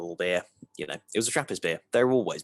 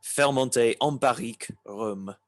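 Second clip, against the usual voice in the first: put-on French accent; 3 semitones higher than usual. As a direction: read the label in a French accent. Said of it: "in his best possible French accent".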